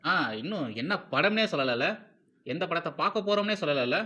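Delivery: ordinary delivery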